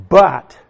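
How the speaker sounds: "But," hit hard and loud, then a pause; strong emphatic but. Shown as a demonstration. Preacher stressing the contrast word for the congregation, "But," punched loud. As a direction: slam down on "But," to mark the contrast. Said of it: "the strong adversative".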